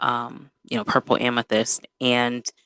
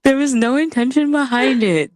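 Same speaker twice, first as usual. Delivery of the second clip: high-pitched mock-defensive protest; squeaky-high playful denial; 12 semitones higher than usual. Real, not acted. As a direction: protest innocence in a much higher voice. Playful protest at being mimicked.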